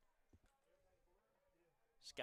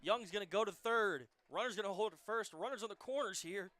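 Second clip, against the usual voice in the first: rising, louder call of the hit; excited play-by-play; 4 semitones higher, 7 dB louder than usual. Real, not acted. Live call of a base hit.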